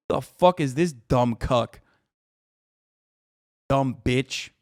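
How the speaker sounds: low, soft, slow disdain; contemptuous aside. Real, not acted. Streamer about the critical chatter, low contempt. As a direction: dismiss them under your breath.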